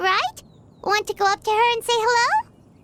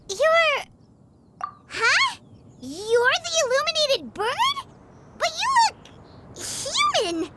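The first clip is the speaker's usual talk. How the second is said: high, slow, wide-eyed disbelief; stunned questioning; astonished; 7 semitones higher, slower than usual. Acted. Paimon realizes the lady is the bird, astonished.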